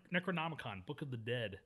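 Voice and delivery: normal talking voice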